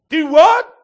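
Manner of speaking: very loud high incredulous; baffled exclaim. Acted. Woman answers the strange man, loud baffled shout. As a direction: bark it back puzzled. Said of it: "And she says".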